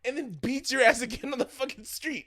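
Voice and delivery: high, shouted comic outrage; really loud. Real, not acted. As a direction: yell the climax at full excitement. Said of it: "I'm getting really loud into it".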